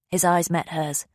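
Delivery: ordinary delivery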